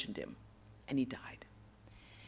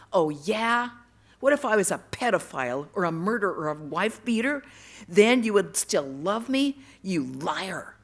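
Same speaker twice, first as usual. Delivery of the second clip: loud, scornful retort; angry challenge; 8 dB louder than usual. Retold or imitated. Furious boy tests the loving voice, loud defiant challenge. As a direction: throw it back as a dare, louder. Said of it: "I challenged him".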